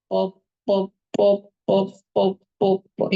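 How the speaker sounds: mock baby babble. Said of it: "small baby doesn't know alphabets".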